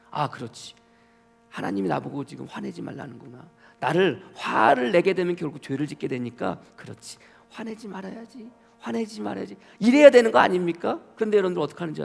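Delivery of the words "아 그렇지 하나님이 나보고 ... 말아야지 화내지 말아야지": soft, musing inner voice; talking to oneself. Acted. Listener taking God's warning to heart, softly musing. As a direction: quietly reason it through with yourself. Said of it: "여러분들이라면".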